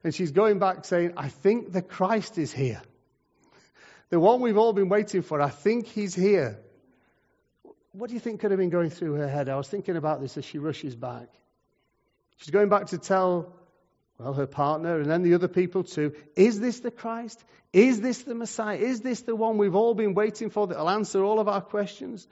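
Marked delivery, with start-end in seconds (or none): 1.2-6.6 acted breaking of big news, pitched up; excited. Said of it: "'cause she's so excited". 16.3-22.3 high, insistent run of questions; acted amazement, pitched well up; excited wondering. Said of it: "'cause she's so excited".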